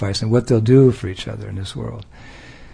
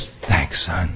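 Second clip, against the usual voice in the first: softer, lower fatherly thanks; indulgent dad; 9 semitones lower, 7 dB quieter than usual. Retold or imitated. Father thanks his son for a gift bought with dad's money. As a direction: thank the child like an amused father. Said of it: "And dad says".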